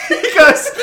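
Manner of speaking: loud laughter breaking the words; laughing. Real, not acted. Laughing while telling story.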